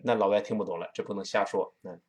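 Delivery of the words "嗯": usual speaking voice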